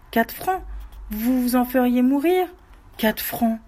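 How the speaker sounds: horrified disbelief at the cost; shocked outrage. Acted. Widow outraged by the digger's price, high shocked cry. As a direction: squeal it high, aghast at the price. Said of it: "Elle eut un sursaut".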